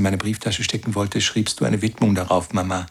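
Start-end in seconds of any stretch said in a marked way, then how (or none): none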